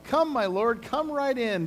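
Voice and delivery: high, coaxing hostess voice; Jael's sweet welcome. Acted. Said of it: "said to him".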